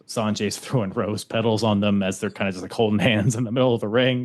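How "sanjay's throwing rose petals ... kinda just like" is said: ordinary delivery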